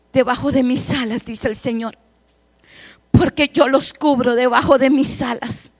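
usual speaking voice

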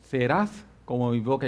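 low, soft, slow voice of God. Retold or imitated. God speaking to the pastor while driving, low solemn voice. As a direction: let it rumble low and slow like a voice inside. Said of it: "esa voz de Dios que retumbó".

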